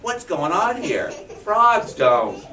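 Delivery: amazed squawky question; astonished birds. Acted. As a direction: birds baffled by flying frogs. Birds in disbelief.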